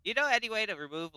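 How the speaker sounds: cheery character voice. Acted. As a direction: pop up loud and high to Gareth. Character hails Gareth mid-flight.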